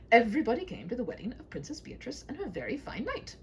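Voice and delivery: usual speaking voice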